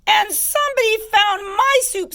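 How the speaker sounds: sputtering, squeaky little cub; high-pitched baby bear voice. A narrator's reading. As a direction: squeak it out indignantly. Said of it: "in her high squeaky voice".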